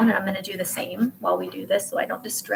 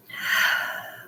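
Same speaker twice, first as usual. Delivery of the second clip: long sighing breath out; slow, releasing sigh; audible exhale. Shown as a demonstration. Demonstrates letting the breath out.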